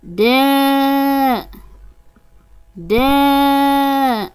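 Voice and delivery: slow, long held vowels on a steady pitch; sustained teaching-drill syllables; drawn-out vowels. Shown as a demonstration. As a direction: stretch each syllable out for learners.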